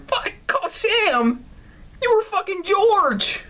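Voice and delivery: high-pitched incredulous yelp; outraged outburst. Real, not acted. Player yelling at the game's cheating girlfriend, outraged. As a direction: exclaim it high in disbelief.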